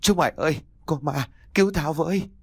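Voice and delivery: put-on high, alarmed old man's wail; panicked cry for help. A narrator's reading. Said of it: "lết ra ngoài cửa gào lên".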